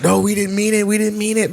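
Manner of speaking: high-pitched mock pleading; hurried retold protest of innocence; shaken up. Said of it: "they're shaken up".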